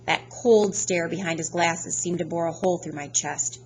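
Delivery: plain reading voice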